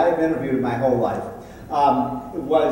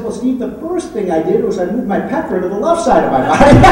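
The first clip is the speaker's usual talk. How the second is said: Art's punchline said higher and louder with a laugh; fighting back laughter; laughing through it; 4 semitones higher, 6 dB louder than usual. Retold or imitated. Retelling Art's joke, about to crack up. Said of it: "He said".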